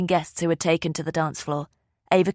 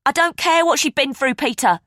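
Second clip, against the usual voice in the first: higher and louder, a furious snap; snapping angrily; 9 semitones higher, 6 dB louder than usual. A narrator's reading. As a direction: bite his head off. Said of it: "Gail snapped".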